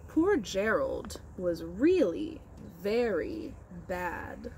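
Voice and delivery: slow, expressive picture-book reading; reading to children. Acted. Teacher reads Giraffes Can't Dance aloud to her class. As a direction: read with wide storybook expression.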